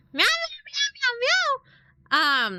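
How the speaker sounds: high, wailing kitten meows; cat meows. Pretend. Cooing at her pet cat with high meows. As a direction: meow up high like a crying kitten.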